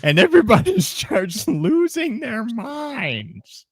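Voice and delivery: high, manic Joker voice breaking into laughs; giggly unhinged villain voice; Joker impression. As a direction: cackle through it like the Joker gone chronically online. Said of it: "this is an impression of the Joker".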